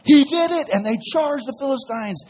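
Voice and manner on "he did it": cheering. Retold or imitated. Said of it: "And Israel's like".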